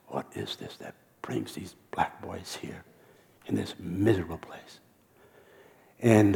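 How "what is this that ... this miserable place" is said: heartbroken whisper. Retold or imitated. Visitor seeing the boys in prison, grieving whisper. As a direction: whisper it like the sight is unbearable.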